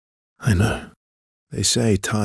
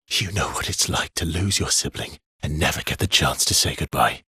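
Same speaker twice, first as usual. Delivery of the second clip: ordinary talk, nothing marked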